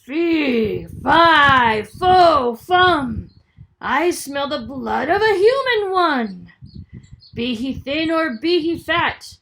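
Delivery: thunderous bellow. Acted. Giant threatening the hidden boy, bellowing. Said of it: "A thunderous voice bellowed".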